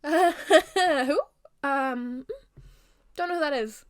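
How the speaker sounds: high, awkward laugh into a bluff; nervous denial. Acted. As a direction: laugh it off and play innocent.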